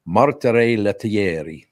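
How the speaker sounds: slow, relished Italian name; Italian accent. Shown as a demonstration. Host revealing Tino's real name, showy Italian accent. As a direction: savour it syllable by syllable in Italian. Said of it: "no more Italian name than that".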